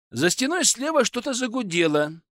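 ordinary delivery, nothing marked

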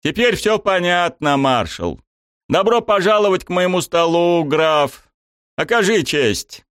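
booming baroness. A narrator's reading. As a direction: welcome him like a boisterous hostess. Baroness invites count to table.

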